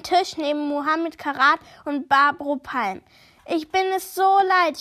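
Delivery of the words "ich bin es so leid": louder, higher exasperated grumble; fed-up shopkeeper griping; grumbling complaint. Acted. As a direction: vent your frustration. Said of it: "schimpft Barbro vor sich hin".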